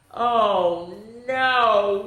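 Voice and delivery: ordinary delivery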